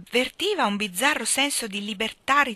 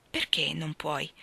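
slower, softer, lower question; calmer; 4 semitones lower, 4 dB quieter, slower than usual. A narrator's reading. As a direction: pull back and ask it calmly. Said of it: "domandò Roy più calmo".

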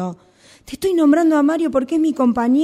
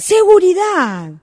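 higher, louder, slow "¡Seguridad!"; indignant disbelief; 8 semitones higher, 5 dB louder, slower than usual. Acted. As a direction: throw the word back in outrage. Employee threatened with security.

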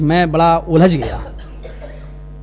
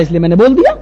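ordinary talk, nothing marked

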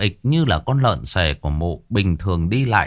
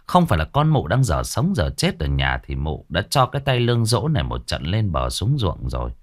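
plain reading voice

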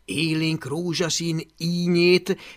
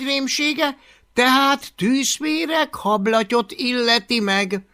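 a touch higher, louder earnest objection; lightly voiced nerdy friend; 7 semitones higher, 5 dB louder than usual. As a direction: stand up for his friend. Halvér védekezik. (enyhén eltorzított, buzgó barát)

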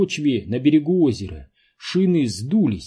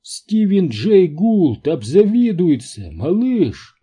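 slow, high, loud exultation; elated; 6 semitones higher, 6 dB louder, slower than usual. A narrator's reading. Квентин gloats to his friend over the discovery, elated. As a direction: crow it slowly to your pal. Awed scientists see living fossils.

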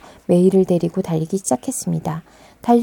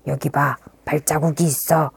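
low wolf voice; 5 semitones lower than usual. Acted. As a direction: lower gruff hunter. Wolf voice, lower. (낮은 늑대 목소리)

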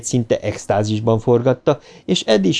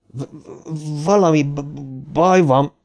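stammering fear; 5 semitones higher, slower than usual. A narrator's reading. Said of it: "mondta Bill".

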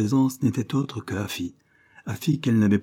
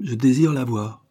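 brisk; 5 semitones higher, 4 dB louder than usual. A narrator's reading. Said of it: "répéta vivement la jeune femme".

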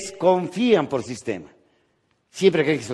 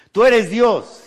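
loud hopeful cry; 8 semitones higher, 8 dB louder, much slower than usual. Acted. Hanging man answers God.

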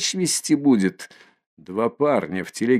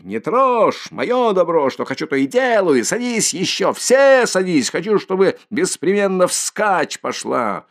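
high, loud furious bellow; drunken defiance; 8 semitones higher, 3 dB louder than usual. Acted. Mikolka snapping back at the crowd, a loud furious bellow. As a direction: roar back at everyone, louder and higher.

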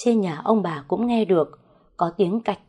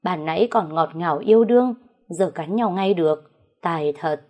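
plain reading voice

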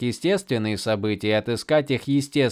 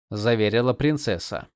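ordinary delivery, nothing marked

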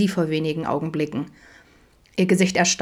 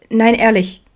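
higher, louder, hurried reassurance; hasty appeasing; 4 semitones higher, 7 dB louder than usual. A narrator's reading. Daughter soothes her angry father, hastily appeasing. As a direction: insist quickly to calm him down. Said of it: "beschwichtigte Eileen schnell".

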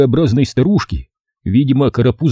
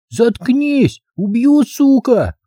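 put-on high, slow childish lisp; squeaky kid aping a husband's row; little boy playing drunk; 13 semitones higher, much slower than usual. Boy playing husband answers nagging wife, high childish lisp. Karapuz plays violent husband.

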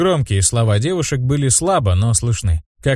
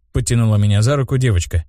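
ordinary delivery, nothing marked

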